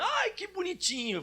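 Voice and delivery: put-on high-pitched gushing over a cute child; imitated cooing. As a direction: coo like a doting parent.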